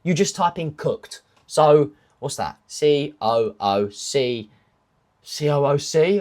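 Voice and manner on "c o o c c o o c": slow spelling. Real, not acted. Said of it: "took me about thirty seconds there just to spell it out".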